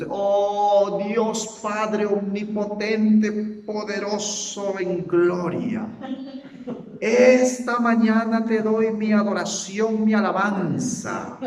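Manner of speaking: slow, loud, high and solemn, stretching every phrase; mock pompous prayer. A pastor mocking showy religious prayer in pompous tones. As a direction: ham up a stiff religious prayer. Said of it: "quiten de su mente".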